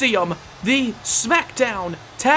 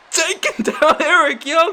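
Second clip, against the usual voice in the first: laughing; 5 semitones higher, 3 dB louder than usual. Real, not acted. Laugh mid-line.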